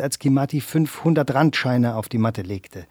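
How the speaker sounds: usual speaking voice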